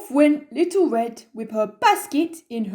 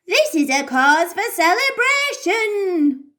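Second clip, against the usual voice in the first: cheery grandma; 7 semitones higher than usual. Acted. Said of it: "said Grandma".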